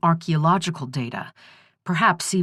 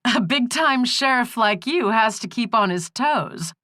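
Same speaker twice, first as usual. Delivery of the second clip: teasing; 7 semitones higher than usual. Acted. Corey teasing the sheriff.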